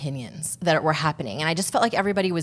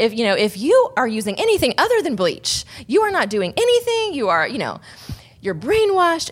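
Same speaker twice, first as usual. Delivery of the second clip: higher, louder, hammed-up scolding; mock judgmental voice; 7 semitones higher, 4 dB louder than usual. Mocking loud social media voices, hammed-up scolding. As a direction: parody a preachy online opinion. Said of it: "and vice versa".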